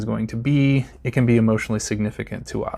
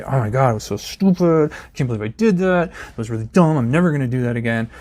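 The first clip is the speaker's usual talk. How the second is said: self-scolding. Retold or imitated. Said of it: "I might say".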